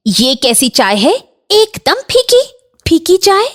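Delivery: fussy aunt, pitch shooting up in complaint; indignant complaint. Acted. Aunt rejecting bahu's tea, high indignant complaint. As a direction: voice leaping high in disbelief.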